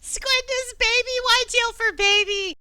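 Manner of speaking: high, loud little creature voice; pixie. Acted. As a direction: squeak it out like a tiny sea creature. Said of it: "a little voice in your head".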